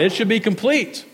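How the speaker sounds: ordinary talk, nothing marked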